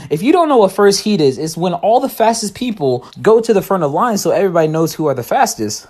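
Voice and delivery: ordinary talk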